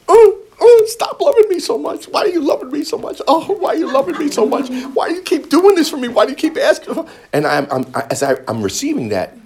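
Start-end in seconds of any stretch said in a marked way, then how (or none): 0.1-7.1 a hammed-up overwhelmed plea, much higher; mock overwhelmed. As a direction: whine playfully at being loved so much. Voicing himself overwhelmed by love.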